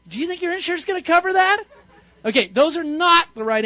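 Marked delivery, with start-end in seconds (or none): 0.1-1.6 rushed fix-it question. Pretend. Mocking the wrong fix-it reply.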